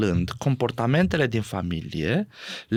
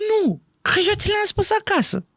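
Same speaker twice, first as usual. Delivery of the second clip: mock defensive voice. Mock reply in quarrel example.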